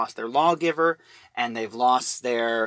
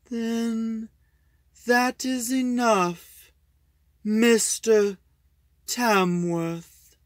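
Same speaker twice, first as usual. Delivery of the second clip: a lightly raised, slow, grave farewell; solemn alien voice; 8 semitones higher, much slower than usual. Acted. Alien elder bids Tamworth farewell, slow and solemn.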